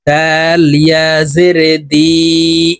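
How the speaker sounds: slow, drawn-out letter-by-letter recitation; Qaida spelling drill. Shown as a demonstration. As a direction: stretch the long vowels on a level tone. (ধীরে টেনে টেনে কায়দার বানান পাঠ)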